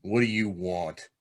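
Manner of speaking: ordinary talk, nothing marked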